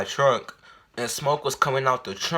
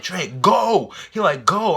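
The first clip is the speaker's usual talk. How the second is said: impatient urging; 7 semitones higher, 5 dB louder, much slower than usual. Retold or imitated. Friend urging at the light.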